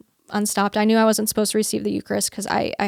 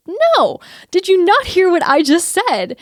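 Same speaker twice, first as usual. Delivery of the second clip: much higher, louder, indignant; exasperated retort; 9 semitones higher, 5 dB louder, slower than usual. Retold or imitated. Arguing with Jesus who keeps asking, exasperated. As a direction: snap back in disbelief.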